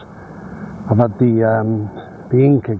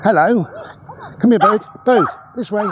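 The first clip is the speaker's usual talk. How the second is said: calling the dog; 10 semitones higher than usual. Real, not acted. Owner guiding her dog Bode over the bridge, high bright call. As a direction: pitch it up to get the dog's attention.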